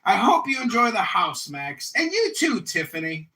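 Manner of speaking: high, loud mock dad voice; imitating his dad. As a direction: ham up the proud father, loud and high. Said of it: "He'll be like".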